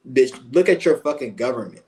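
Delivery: normal talking voice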